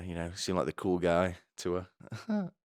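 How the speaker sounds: usual speaking voice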